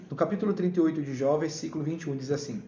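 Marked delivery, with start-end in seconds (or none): none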